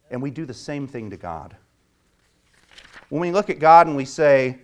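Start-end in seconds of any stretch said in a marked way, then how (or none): none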